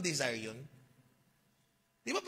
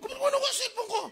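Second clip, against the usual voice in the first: high mock voice; 3 dB louder, faster than usual. Mimics companion on trip.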